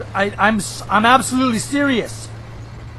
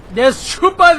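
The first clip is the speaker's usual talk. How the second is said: excited call-out; 6 semitones higher, 4 dB louder than usual. Real, not acted. Gamer hollering an enemy's name at his viewers, excited shout. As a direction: shout the name out, higher and louder.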